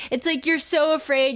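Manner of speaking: ordinary talk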